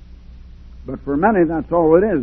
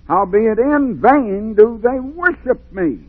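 slow scripture reading; 7 semitones higher, slower than usual. Acted. Reads scripture with preaching force.

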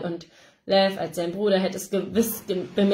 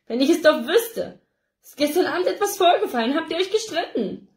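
worried, accusing mother, higher and louder; worry and reproach; 8 semitones higher, 4 dB louder than usual. A narrator's reading. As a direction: press the son for answers anxiously. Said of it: "kämpfen Sorge und Vorwurf".

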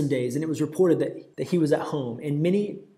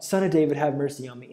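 normal talking voice

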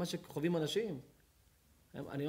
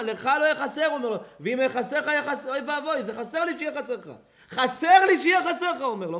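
high, loud preaching run; preaching at full force; 10 semitones higher, 10 dB louder than usual. Acted. Preacher retells a friend's vow to the congregation, full-force preaching.

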